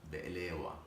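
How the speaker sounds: be'elewa repeated softly, k dropped and w for v; Middle Eastern pronunciation. Shown as a demonstration. Cook repeats the native word for viewers, Middle Eastern pronunciation. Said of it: "that's how we say it".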